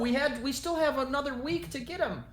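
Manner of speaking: high-pitched, defensive homeowner; nervous woman pleading her case; flustered older woman. Acted. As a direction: pitch it high, anxiously making excuses. Game master plays the older woman.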